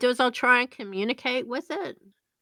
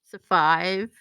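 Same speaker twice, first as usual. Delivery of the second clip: ordinary talk, nothing marked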